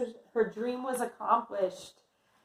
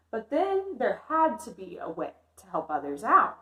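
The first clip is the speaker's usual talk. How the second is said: put-on lively read-aloud for young kids; story narration; 5 dB louder than usual. A narrator's reading. Teacher reading a picture book to kindergartners, lively narration. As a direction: read the story warmly to the class.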